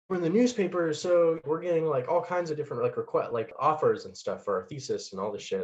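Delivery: high mock-excited voice, settling toward the end; imitated excited friend. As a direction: imitate a thrilled friend, starting high and easing down. Voices the friend's thrilled news.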